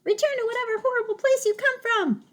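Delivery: very high, loud frightened squeak. Acted. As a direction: squeal it out, cowering. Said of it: "squeaked Dart".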